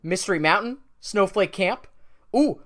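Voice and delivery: usual speaking voice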